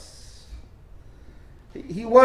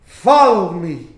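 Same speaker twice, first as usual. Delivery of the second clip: slow, lower, humble invitation; soft, humble; 4 semitones lower, 3 dB louder, slower than usual. Acted. Jesus imagined calling Matthew humbly, soft humble tone. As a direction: say it slowly and humbly, pitch dropped. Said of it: "in a soft tone, in, in a very humble voice".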